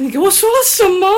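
exasperated inner retort. Retold or imitated. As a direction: fire back at him in exasperated disbelief. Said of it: "我心想". (内心崩溃地快速反问)

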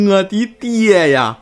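slow, drawn-out mock wail; wailing call. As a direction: stretch out a hammed-up cry for father.

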